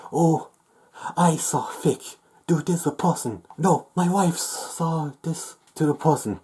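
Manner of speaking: high-pitched mock quote of an accuser. Mocking an accuser's claims.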